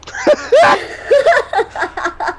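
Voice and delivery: shouted burst of laughter. Real, not acted.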